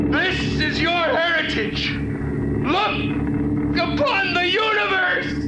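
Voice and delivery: rising prophetic fervour. Acted. Hoyland defies his killers, building to a shout. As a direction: proclaim it to the heavens. Climax of Hoyland's defiant speech.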